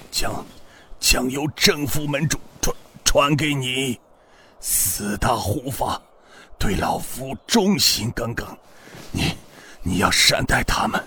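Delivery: dying words. Acted. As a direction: stammer out the last words as life fades. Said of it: "断断续续地说道".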